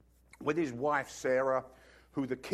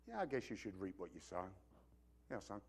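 dad's quiet reply; 8 semitones lower, 16 dB quieter than usual. Retold or imitated. Dad answering son.

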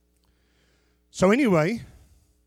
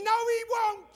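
very high squeaked denial; sharp retort. Acted. Preacher mocking the cliché, high squeaky retort. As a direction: snap back in a high voice.

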